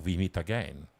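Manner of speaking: put-on slow, low and soft European villain accent; generic European villain accent. Villain taunting Bond, in a European accent. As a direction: menace him softly in a European accent. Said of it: "in a generic European accent".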